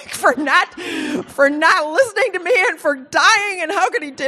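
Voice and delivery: high-pitched mock-bawling complaint; retold tearful anger. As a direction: wail it out as if crying mad. Reliving crying, mad at husband.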